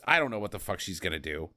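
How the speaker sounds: ordinary delivery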